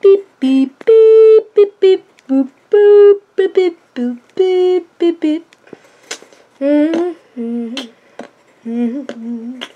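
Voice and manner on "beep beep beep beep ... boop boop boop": loud high beep boops; robot beeps. Pretend. Robot noises.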